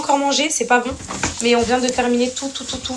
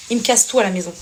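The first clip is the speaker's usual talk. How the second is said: ordinary talk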